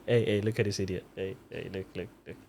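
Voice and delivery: low, hushed aside to a sidekick; acted quiet, incredulous nudge; God's mocking aside. God confiding in an angel.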